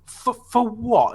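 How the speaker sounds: high-pitched, slow, stuttered disbelief; mock baffled protest. Pupil to teacher after being sent out, mock baffled.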